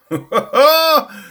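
high, loud whoop of laughter. Real, not acted. Comic reaction to distractions.

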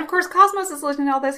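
ordinary talk

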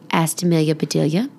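usual speaking voice

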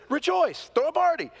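high, loud cry of joy; joyful call. Acted. Woman calling her neighbors to celebrate, high joyful cry. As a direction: call out like throwing a party. Woman calls friends to rejoice.

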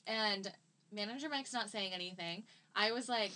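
ordinary talk

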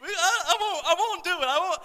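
high, loud mock blubbering; caving-in dad. Father giving in to a pleading daughter, hammed-up blubbering. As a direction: give in with a hammed-up high wobble. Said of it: "I was like".

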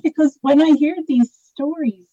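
real emotion breaking through a nervous laugh; moved to tears, talking fast to hold it together; emotional. Real, not acted. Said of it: "I'm getting a bit emotional here".

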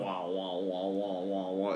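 low, soft mumbling wah wahs; muffled wah wah talk. Retold or imitated. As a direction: mimic muffled game chatter. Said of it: "and then they go like".

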